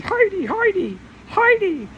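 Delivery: calling voice. Retold or imitated. Imitating voice calling her name.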